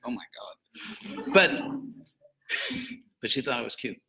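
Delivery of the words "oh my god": breathless slow whisper of dismay. Retold or imitated. Lecturer recalling old baby pictures, slow appalled whisper.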